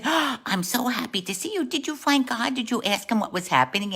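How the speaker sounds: put-on high, eager young lady; delighted greeting. Young lady welcomes the young man back, high and excited.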